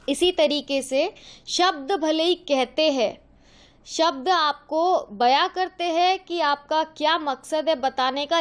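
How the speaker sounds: ordinary talk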